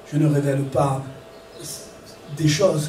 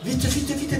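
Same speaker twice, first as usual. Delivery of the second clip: ordinary talk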